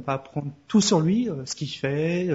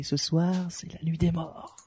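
spooky whisper; 6 dB quieter than usual. Acted. Spooky Halloween intro.

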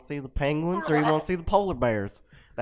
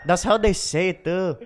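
put-on Filipino accent; 5 semitones higher, 5 dB louder than usual. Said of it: "Filipino accent".

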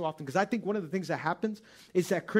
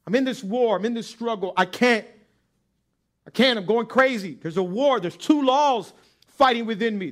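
louder, rising, anguished outcry; Paul's desperate cry; 5 semitones higher, 8 dB louder than usual. Acted. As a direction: plead for rescue, building to a cry.

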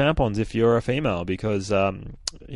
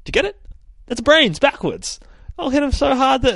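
much higher and louder, gleefully pleased with himself; eager squeal; 6 dB louder than usual. Acted. Ruckus explaining his zombie pun to the group, squealing with glee. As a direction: squeak it out, thrilled with his own joke.

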